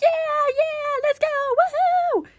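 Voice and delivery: very high, squealed whooping; cheering. Acted. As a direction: whoop like a thrilled fan, hammed-up. Acting crowd cheering after show.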